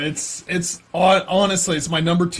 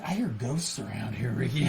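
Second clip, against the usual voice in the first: low, soft imitation of a character; quietly acted-out confession; hushed movie quote; 7 semitones lower, 12 dB quieter than usual. Acting out a movie line.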